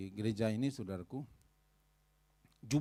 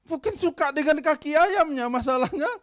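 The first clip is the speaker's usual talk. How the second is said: emphatic loud point; 13 semitones higher, 11 dB louder than usual. Real, not acted.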